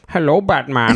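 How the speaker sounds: a hint of a British-hybrid Bane; lightly voiced British villain; mock Bane, British tinge; weird British Bane. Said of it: "a weird British hybrid".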